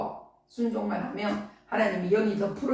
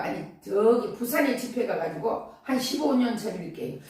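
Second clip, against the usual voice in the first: ordinary talk, nothing marked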